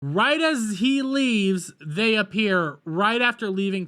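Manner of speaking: high, loud, slow sarcastic emphasis; mock incredulity. Real, not acted. Reviewer mocking the convenient timing, incredulous. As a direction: stretch it out in disbelief.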